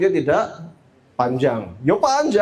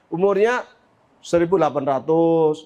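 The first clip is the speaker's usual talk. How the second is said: usual speaking voice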